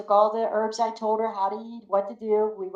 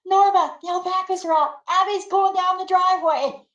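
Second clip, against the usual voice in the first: much higher, louder alarm call; yelling; 11 semitones higher, 4 dB louder than usual. Retold or imitated. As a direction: shout across the yard to Norma. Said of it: "I yelled at her".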